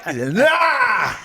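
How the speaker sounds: loud, high comic wail; hammed-up scream of protest; mock pained yell. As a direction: cry out as if being bitten. Acting out being bitten.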